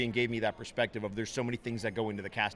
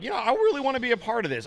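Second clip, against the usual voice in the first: eager plea; 12 semitones higher, 6 dB louder than usual. Retold or imitated. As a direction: voice jumps way up, eager and pleading. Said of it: "So I was like".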